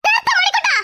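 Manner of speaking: high, sharp command to stop. Acted. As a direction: cut him off in a high, sharp voice. Woman cuts off her husband.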